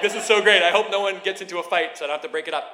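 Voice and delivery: loud, high gushing, speeding up; thrilled onlooker's rush of words; giddy excitement. Retold or imitated. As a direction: gush loudly, then rattle on fast. Retold glee at watching a quarrel.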